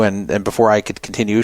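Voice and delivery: ordinary talk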